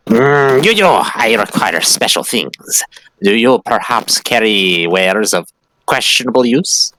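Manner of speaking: halting, scheming little creature voice; furry rat-creature customer. Acted. Furry creature asking merchant for special wares, halting scheming voice. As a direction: ask furtively, in stilted creature speech.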